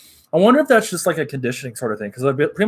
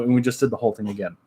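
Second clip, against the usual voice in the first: ordinary delivery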